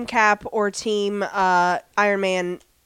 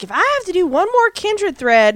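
high, loud exasperated complaint; bitching; 9 semitones higher, 6 dB louder than usual. Retold or imitated. Character complaining about the thread, exasperated and bitching. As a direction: gripe it out, pitched way up. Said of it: "and she's bitching".

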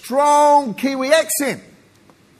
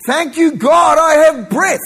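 raised, louder grateful cry; joyful thanks; 5 semitones higher, 6 dB louder than usual. Shown as a demonstration. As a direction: burst out thanking God. Models a grateful prayer aloud.

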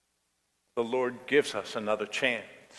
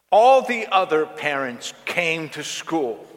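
higher and louder, building outrage; angry teen rant; 6 semitones higher, 6 dB louder than usual. Retold or imitated. Daughter ranting at her mother about other parents, angry and loud. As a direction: pile up every grievance in a furious rush.

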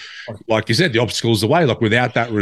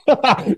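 high laugh; 3 dB louder than usual. Real, not acted.

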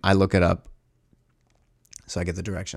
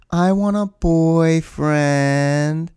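very slow, drawn-out, higher; imitating her flat line; 5 semitones higher, much slower than usual. Imitating his date telling him what she wants, very slow. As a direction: drag every word out blankly. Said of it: "like, just like this".

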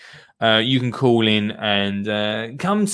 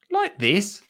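high-pitched little question; Dottie's child voice; 8 semitones higher than usual. Acted. Dottie shows Lottie a pot, high child-character voice. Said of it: "said Dottie".

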